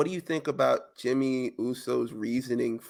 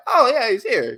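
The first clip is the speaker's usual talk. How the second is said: high, louder sudden realization; suddenly notice him, pitched up; mock surprise; 9 semitones higher, 6 dB louder than usual. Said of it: "They're like".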